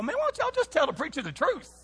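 high-pitched imitation of a boy; high mock-kid voice; little boy. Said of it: "I love little boys. They go".

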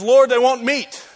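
lightly acted exasperation; fed up. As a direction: complain upward to God, fed up. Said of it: "Moses gets fed up".

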